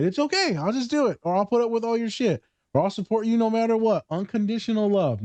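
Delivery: sing-song yes-to-everything voice; people-pleaser voice. Retold or imitated. Mocking the friend who puts up with everything, high sugary voice.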